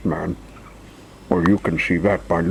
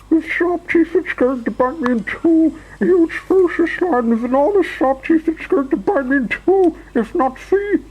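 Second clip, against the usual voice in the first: very high, panicky shriek; shrieking Mister Jelly; 4 dB louder than usual. A narrator's reading. Mister Jelly thinks a huge lion will bite him, shrieking. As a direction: squeal in terror, getting more frantic. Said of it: "shrieked Mister Jelly".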